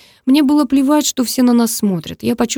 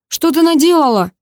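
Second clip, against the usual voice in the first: high, raised angry outburst; furious demand; 8 semitones higher, 4 dB louder than usual. A narrator's reading. Enraged brother rushes at his sister, high furious demand. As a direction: round on them in fury.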